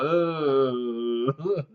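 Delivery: mock retch. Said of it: "it's like".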